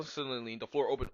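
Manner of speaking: usual speaking voice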